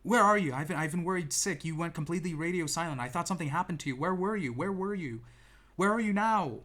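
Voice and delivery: high, loud frantic worry; worried sister. Retold or imitated. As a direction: imitate the panicked sister calling out. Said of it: "and she's like".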